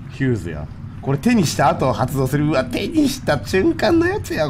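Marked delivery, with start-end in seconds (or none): 2.5-4.5 squeal of alarm. Real, not acted. Expecting trap on pickup.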